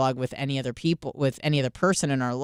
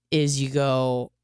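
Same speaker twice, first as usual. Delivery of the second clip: normal talking voice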